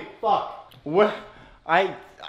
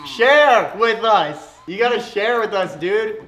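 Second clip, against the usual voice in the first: whiny plea; 3 semitones higher, 7 dB louder, much slower than usual. Real, not acted. Begging to share pepperoni.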